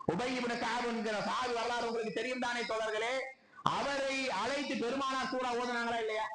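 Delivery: high-pitched, sustained sermon appeal; impassioned preaching. Real, not acted. Preacher asks congregation if the Quran moves them, impassioned.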